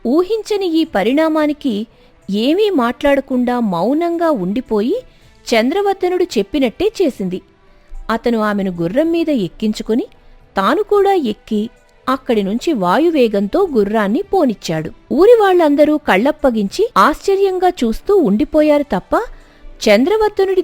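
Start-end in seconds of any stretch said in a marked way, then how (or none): none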